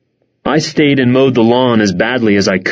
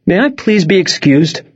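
higher, trembling plea; voice shaking; 6 semitones higher than usual. Acted. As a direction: voice shakes a little, holding back tears. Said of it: "Her voice shook a little".

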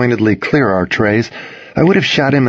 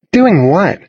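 put-on incredulous girl; 9 semitones higher, 4 dB louder than usual. A narrator's reading. Girl pressing him.

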